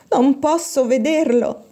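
a mild, slow lament; repeated lament. A narrator's reading. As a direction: say it slowly like a girl who can't stop grieving. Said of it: "ripeteva ancora Giulia Scarano".

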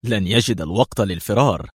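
plain reading voice

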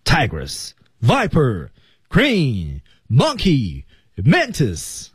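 each name set apart, "Tigress" to "Mantis" each falling; pause after each name. Shown as a demonstration. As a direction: list them one by one with a pause. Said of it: "后面每一个单词停顿一次".

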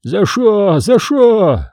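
high, plaintive wail; acted sobbing, pitched high; tearful sobbing. A narrator's reading. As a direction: plead high through tears. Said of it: "Поп всхлипывал".

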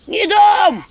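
high loud shrieking refusal; squeal. Acted. House spirit squealing as imps grab at him. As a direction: shriek it in panic. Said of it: "взвизгнул домовой".

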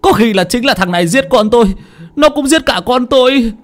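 high, bitter accusation; accusing parent. Acted. Grieving parent urging the crowd to seize Hoạch, high and bitter. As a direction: demand they seize him.

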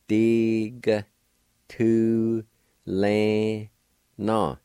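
"Dig tu le na" in separate beats; syllable by syllable. Shown as a demonstration. Teacher sounding out the Cherokee word for car. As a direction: say "Dig tu le na" one syllable at a time. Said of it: "like this if you want to".

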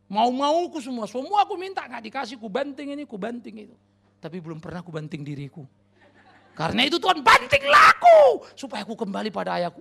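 building from loud to a laughing shout; a stubborn child's confession bursting out; child's prayer. Acted. Child confesses rebellion to God, rising to a shout. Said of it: "Anak-anak juga mulai doa".

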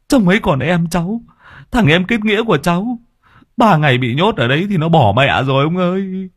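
put-on young thug begging the old man to save his brother; desperate pleading. A narrator's reading. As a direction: beg the old man as if weeping. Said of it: "vội vã van xin".

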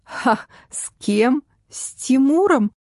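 scoff, then balk at the name; amazed double-take; incredulous. A narrator's reading. Shocked at the name of Timur.

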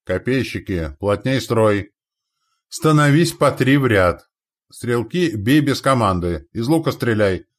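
loud battlefield commands; shouted orders. A narrator's reading. Андрей forms spearmen against a cavalry charge, shouting orders. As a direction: drive each order out to the ranks.

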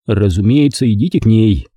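usual speaking voice

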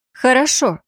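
bright confident answer; ringing reply. A narrator's reading. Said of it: "звонко ответила я".

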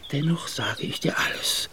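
soft, breathy whispered confession; confiding whisper. Acted. The gendarme confesses his secret to the Effendi, whispering. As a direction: lean in and whisper the betrayal.

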